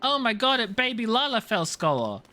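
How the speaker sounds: usual speaking voice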